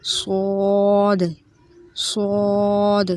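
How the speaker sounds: صاد slow and thick, lips rounded, mouth full of air; rounded heavy صاد; heavy letter. Shown as a demonstration. Teacher stretching out a heavy صاد for students. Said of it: "یہ موٹا پڑھا جانے والا حرف ہے".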